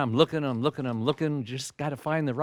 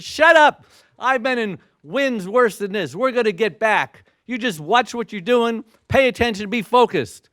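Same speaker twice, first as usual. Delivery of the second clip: loud, high commanding shout to steady the crew; forceful captain calling out; taking charge; 6 semitones higher, 5 dB louder than usual. Retold or imitated. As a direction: bark orders over the storm. Captain snapping crew back.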